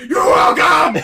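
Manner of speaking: very loud, high, cheesy hero shout; hammed-up heroic shout. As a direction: bellow it like a proud action hero. Hero answers the parent.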